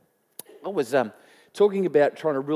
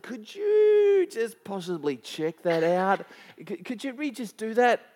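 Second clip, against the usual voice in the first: slow, higher, carefully courteous ask; overly polite request; 6 semitones higher, slower than usual. Retold or imitated. Pastor at cafe counter querying the bill, extra polite and slow. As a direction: tiptoe the question as politely as possible. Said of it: "So I politely".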